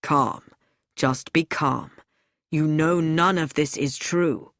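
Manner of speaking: low, steady soothing voice; a man calming someone holding a gun; calm reassurance. A narrator's reading. Lewis soothing a raging Edgar.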